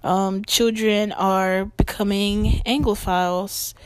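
ordinary talk

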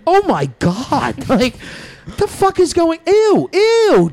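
gross-out shriek; disgusted coworkers. Retold or imitated. Coworkers finding the dead bunnies, mock disgusted squeal. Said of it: "show up the next morning and be like".

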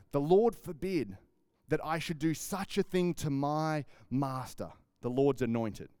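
ordinary talk, nothing marked

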